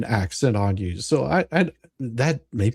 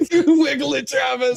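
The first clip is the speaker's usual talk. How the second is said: much higher, laughing call-out; laughing cheer; 12 semitones higher than usual. Real, not acted. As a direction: cheer him on through laughter, pitched way up. Cheering the dancing man on video.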